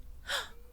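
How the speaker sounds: breathless stunned gasp; high airy gasp. Acted. Gasping again at the werewolf's news. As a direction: suck in air, stunned.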